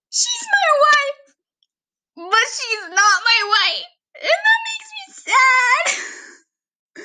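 high, drawn-out whiny crying; mock tearful wail. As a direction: wail it like an overtired crybaby. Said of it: "And I'll just cry".

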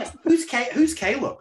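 high-pitched, put-on confusion; mock puzzled listener. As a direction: play a clueless listener in a high voice. Voicing puzzled listeners, joining the joke.

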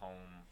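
"poem" as one syllable, pome; imitated pronunciation. Cohost denying they talk like grandma, "poem" as pome. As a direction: make "poem" rhyme with home. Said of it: "I don't say poem".

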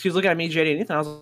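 ordinary delivery

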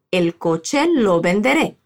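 "El coche lo venderé" slow, with a question-like rise; slow model phrase. Shown as a demonstration. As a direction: model it slowly. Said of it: "Te toca repetir".

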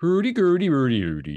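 sing-song mock-Swedish gibberish, starting high and dropping; Swedish Chef. Joking with the co-host, cartoon Swedish gibberish. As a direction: burble nonsense loudly in a cartoon Swedish lilt. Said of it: "Well, that's the Swedish chef".

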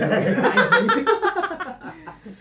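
loud high-pitched laughter. Real, not acted. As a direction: burst out laughing. Laughing at last episode joke.

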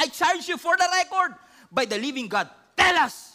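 loud, high demand; high priest demanding Jesus confess; stern high priest. Acted. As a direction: press him hard for an answer.

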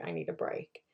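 breathy whisper to herself; whispered inner voice. Retold or imitated. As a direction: whisper it like a secret wish. Inner voice quoted.

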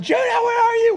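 very high, loud searching yell; full-voiced re-enacted shout. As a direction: yell across the yard for your child. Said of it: "I'm yelling".

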